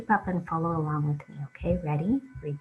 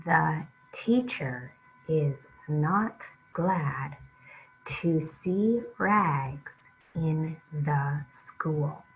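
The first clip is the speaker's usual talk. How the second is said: slow, halting read-along, each word apart; slower than usual. Shown as a demonstration. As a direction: read it slowly, one word at a time for the kids. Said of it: "read it a little bit more smoothly now".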